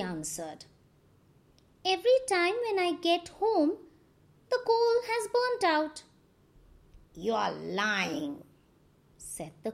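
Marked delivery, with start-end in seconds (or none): 1.8-6.0 higher, quick trickster voice; Anansi's voice. A narrator's reading. Anansi answers the crows. 7.1-8.4 lower, slow accusing crow voice. A narrator's reading. Crows accuse Anansi.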